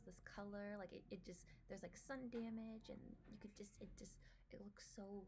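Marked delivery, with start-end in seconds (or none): 2.9-5.2 whisper. Real, not acted. Awed at trailer details.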